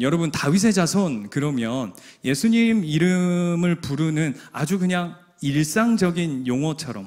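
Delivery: ordinary delivery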